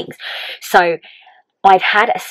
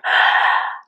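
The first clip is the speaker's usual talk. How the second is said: re-enacted shocked gasp; breath-catching gasp. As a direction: gasp as if your breath just went. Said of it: "my breath literally went".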